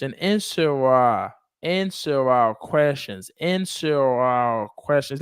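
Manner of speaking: "answer our questions" drawn out, words linked; slow linked drill. Shown as a demonstration. Teacher modelling linking for a student, slow drawn-out drill. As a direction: stretch "answer our questions" slowly for repeating.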